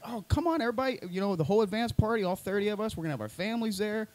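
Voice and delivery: high, cheery mock invitation; chirpy organizers. Unit folks inviting everyone, high cheery voice. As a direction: sing it out like a peppy organizer. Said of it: "They're like".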